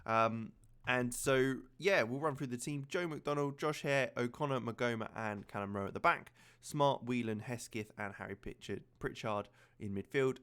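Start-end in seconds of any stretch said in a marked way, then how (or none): none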